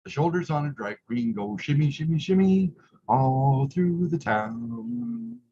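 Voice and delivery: plain reading voice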